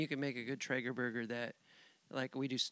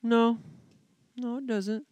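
mock rabbit; 9 semitones higher, 6 dB louder than usual. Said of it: "the rabbit's like".

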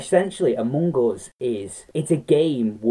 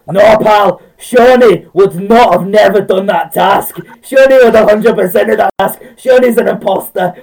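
Kyle's loud accusation; 8 semitones higher, 11 dB louder than usual. Retold or imitated. Retelling Kyle's loud, high accusation to the hosts. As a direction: mimic Kyle shouting down the lobby. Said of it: "we had Kyle saying".

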